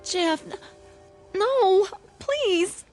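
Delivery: high, halting, panicky refusal; frightened pleading. Acted. Girl begging suitor not to kiss her, frightened.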